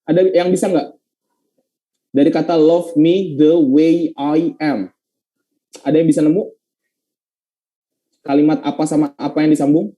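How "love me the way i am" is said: slow, each word set apart; measured classroom dictation pace; slow model reading. Shown as a demonstration. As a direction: read "love me the way I am" slowly for learners. Teacher rereads the example slowly.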